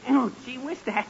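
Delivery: normal talking voice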